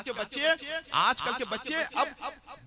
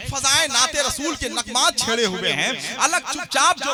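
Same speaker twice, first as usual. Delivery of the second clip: ringing recitation, much louder; loud poetic declamation; 4 semitones higher, 9 dB louder than usual. Acted. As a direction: proclaim each line to the hall. Host declaims couplet and prose. (ज़ोरदार अंदाज़ में शेर पढ़ना)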